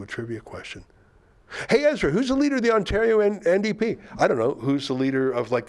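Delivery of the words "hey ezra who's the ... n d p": high, quick put-on questioner; imagined questioner. Someone quizzing the news host, high and eager. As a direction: pitch it up and fire the question.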